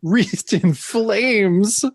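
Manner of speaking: high, slow and drawn out; dramatic caption. Retold or imitated. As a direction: stretch it out like a spooky storyteller. Dramatic reading of comic caption.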